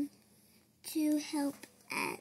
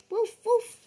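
higher, louder woofs; puppy bark; 6 semitones higher, 5 dB louder than usual. Acted. Boy barking as Biscuit. Child barks.

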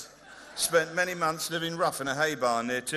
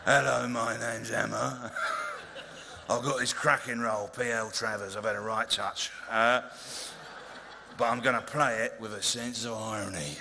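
low calm Emma impression; 5 semitones lower than usual. Mocking Emma Thompson in her own low calm voice at an awards speech. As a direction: send her up gently, low and unhurried. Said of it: "she talks a bit like that".

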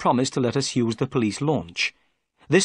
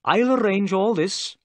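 higher, apologetic policeman voice; a proud officer owning up to a mistake; humbled inspector; 9 semitones higher, 3 dB louder than usual. A narrator's reading. Jones apologises to Watson for freeing Sholto, higher and contrite. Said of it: "said Jones".

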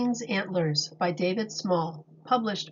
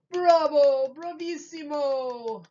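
cheering assistants; 5 semitones higher, 3 dB louder, slower than usual. Acted. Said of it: "cried his assistants".

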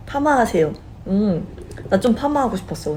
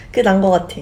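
usual speaking voice

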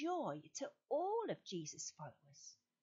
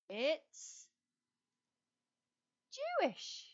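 much higher, loud announcement; spring the answer on the kids; grand reveal; 5 semitones higher, 4 dB louder, much slower than usual. Acted. Host revealing the answer to children, excited.

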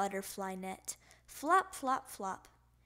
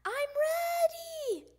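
high, loud, stretched-out shout; little frog hollering back; excited yell; 4 dB louder, slower than usual. A narrator's reading. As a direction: yell it back slowly and proudly. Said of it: "yelled Froggy".

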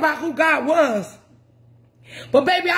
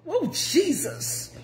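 breathy aside; 8 dB quieter, much slower than usual. Real, not acted. Preacher overcome mid-story.

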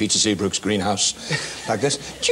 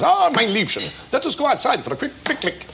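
hammed-up German officer accent; 13 semitones higher than usual. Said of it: "Germans always speak with their heels".

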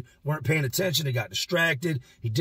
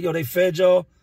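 raised call to the next cells; yelling down; 5 semitones higher, 7 dB louder than usual. Retold or imitated. Inmate yelling to the dudes in nearby cells. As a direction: call it out loud down the row. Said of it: "I yell down to the dudes".

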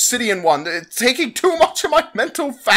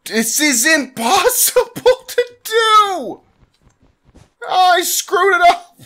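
much higher, strained laugh-cry; laughing despair; 11 semitones higher than usual. Real, not acted. Gamer failing again, laugh-crying in frustration. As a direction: wail half laughing at yourself. Keeps failing a fast minigame.

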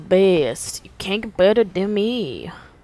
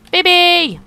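much louder, higher shout of the name; calling out; 9 semitones higher, 9 dB louder than usual. Real, not acted. Player shouting for the droid BB, a loud call. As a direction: call the droid across the map.

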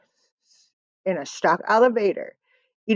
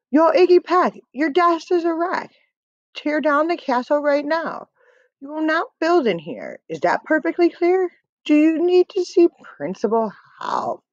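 strict teacher scolding a pupil, pitched high; bossy schoolteacher laying down the law; a high, scolding classroom voice; 7 semitones higher than usual. Acted.

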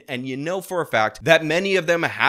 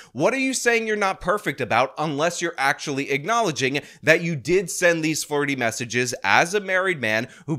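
ordinary talk

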